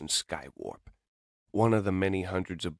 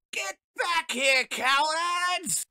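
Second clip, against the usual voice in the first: taunting villain robot; 3 dB louder, much slower than usual. Acted. Villain chasing the fleeing heroes.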